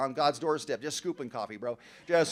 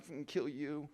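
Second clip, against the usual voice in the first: softer, a half-laughing mock threat under the breath; muttered threat; 2 semitones lower, 11 dB quieter than usual. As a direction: toss it off quietly, half amused.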